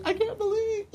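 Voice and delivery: very high squeal breaking into laughter; mock disbelief. Reacting to men, high laughing squeal of disbelief. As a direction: squeak it, hammed-up and giggly.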